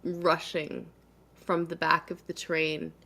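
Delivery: ordinary delivery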